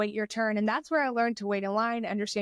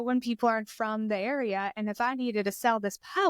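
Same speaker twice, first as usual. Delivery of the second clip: usual speaking voice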